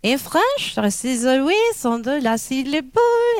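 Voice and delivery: heavy French accent, each last syllable lifted. Shown as a demonstration. Lecturer demonstrating French stress to class, French accent.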